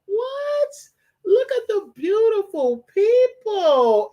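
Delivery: very high, slow, crying squeal of welcome; squealing high greeting. Acted. Squealing a very high slow welcome to his viewers. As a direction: coo at the viewers in a tiny high voice.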